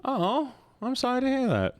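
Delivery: very high sympathetic squeak; high cutesy voice. Acted. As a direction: coo it up high.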